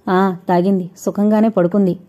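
ordinary delivery

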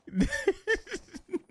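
breathless disbelieving giggle; high giddy laugh. Real, not acted. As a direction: laugh in shocked delight. Disbelieving laugh after heist.

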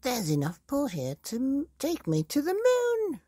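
very high, loud excited shriek; shrieking high old wife; Mrs Twit crying out. Acted. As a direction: squeal it up to the moon. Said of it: "Missus Twit cried out".

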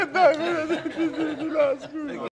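loud, high, laughing apology. Real, not acted. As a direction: burst out laughing. Laughing fit.

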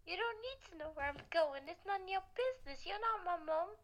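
put-on sassy teen lines with a faint British accent; slipped-in British accent. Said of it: "why did you become British?".

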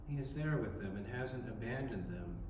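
ordinary delivery

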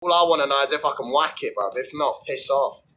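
ordinary talk

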